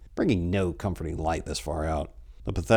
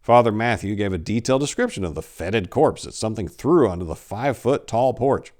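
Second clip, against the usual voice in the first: ordinary delivery, nothing marked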